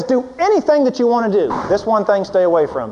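loud, high, driving sermon voice; preaching at full force. Acted. Preacher building up about Satan going after Eve, loud and high. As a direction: build the point and hammer it home.

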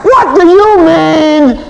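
slow, loud and high, drawn out; mock aggressive challenge. Husband smashing back at wife, mock loud challenge. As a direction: fire it back at her.

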